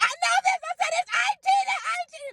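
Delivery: very high screamed answer; screaming excitement. Retold or imitated. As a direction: scream the title out, overjoyed. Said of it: "And I'm screaming".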